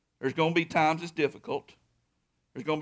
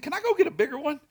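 much higher, hopeful plea; hopeful asking; 10 semitones higher, faster than usual. Retold or imitated. Husband asks his wife for a bigger bike, hopeful and much higher. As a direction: ask it like a boy begging his mother. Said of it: "I said".

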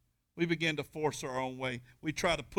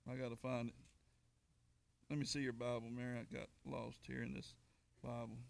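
much softer muttered aside; quiet aside; 3 semitones lower, 13 dB quieter than usual. Real, not acted. Preacher quietly asks Mary for her Bible, soft aside. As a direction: drop out of the pulpit voice to ask quietly.

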